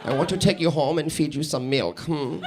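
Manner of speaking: low, soft, creepy German flirt; mock German accent with a quiet hum after. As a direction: murmur it like a creepy German suitor. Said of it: "but they're creepy. It's their accent".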